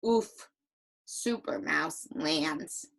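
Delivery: low, slow, downcast reading; slow and sorry, pitched low; hurt, a little sad. Shown as a demonstration. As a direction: sink down sadly after a wince. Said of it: "He's hurt and probably a little sad".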